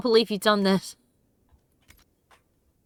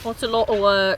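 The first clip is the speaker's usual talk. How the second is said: put-on British accent. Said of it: "That's the way they say that".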